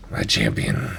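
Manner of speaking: very low, growling dream monster; deep, menacing voice from a nightmare. Acted. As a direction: growl it from far down in the throat. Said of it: "You can hear that growl".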